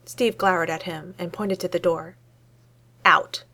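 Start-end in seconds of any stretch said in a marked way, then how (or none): 3.1-3.4 curt order. Acted. Steve orders Tony out.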